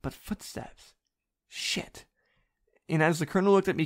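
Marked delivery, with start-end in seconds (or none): 0.0-2.0 whisper. Acted. Alarm at footsteps.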